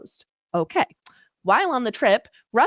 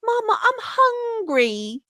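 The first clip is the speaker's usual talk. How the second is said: high, slow whiny urchin; old london cockney; 11 semitones higher, slower than usual. Retold or imitated. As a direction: whine like a Victorian street urchin. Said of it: "the spiritual woman's voice for Merv".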